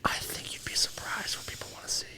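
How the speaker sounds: soft teasing whisper. Real, not acted. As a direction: whisper it slyly.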